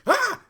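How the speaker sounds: shocked shriek. Real, not acted. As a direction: let out a short yelp of shock. Shocked at the video scene.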